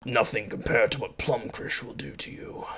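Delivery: hushed, threatening whisper; menacing whisper. Acted. Orc threatens captive cleric.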